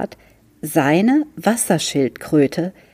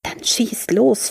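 whispered; 7 semitones higher than usual. A narrator's reading. Fritz whispers to the turtle so his sister won't wake. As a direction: lean in and whisper so no one hears. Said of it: "flüsterte er".

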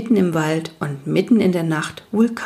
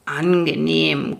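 low, slow creaking growl of a stone giant; creaky rock-biter voice; 3 semitones lower, slower than usual. Acted. Rock biter greets the newcomer by the fire, slow creaky rumble. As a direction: grind the words out slowly like rock. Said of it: "knarrte der Felsenbeißer".

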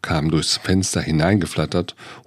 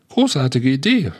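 excited; 7 semitones higher than usual. Acted. Lion excited about plan.

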